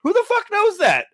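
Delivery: high, disbelieving squawk; who would even know that, shot up high; incredulous outburst. Real, not acted. Reading a news story, he blurts out in disbelief. Outraged at the article's claim.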